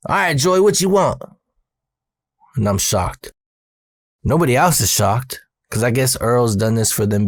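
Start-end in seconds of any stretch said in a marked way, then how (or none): 0.1-1.2 higher-pitched boss impression; imitated boss, pitched up; Earl taking an order. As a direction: call back to a worker for his order. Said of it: "he says".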